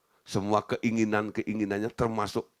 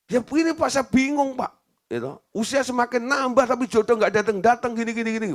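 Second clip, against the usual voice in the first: high, loud pleading daughter; whiny complaint; 11 semitones higher, 4 dB louder than usual. Retold or imitated. Daughter pours out her worry to the pastor, high and pleading.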